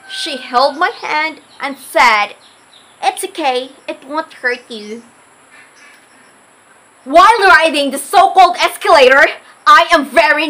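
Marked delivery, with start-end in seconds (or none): 3.0-5.0 soft, gentle comfort; calm a scared child; reassuring. Retold or imitated. Aunt comforts scared niece.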